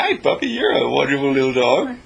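sweet little puppy-talk voice; a light touch of squeaky baby talk for a dog; squeaky friendly voice. Retold or imitated. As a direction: coo at the dog like you're its friend. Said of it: "that squeaky little voice of being friendly".

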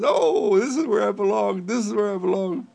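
high, loud happy fish voice; joyful fish back in its pond, high and loud. Pretend. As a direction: squeal with joy like a fish back home. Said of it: "it wiggles its tail and it swims down into the water and says".

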